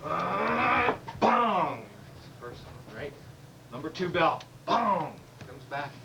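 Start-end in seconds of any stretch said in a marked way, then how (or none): 1.2-1.8 mock bell bong. Imitating a church bell. 4.7-5.1 mock bell bong. Imitating a church bell.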